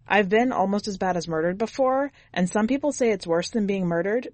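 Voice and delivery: usual speaking voice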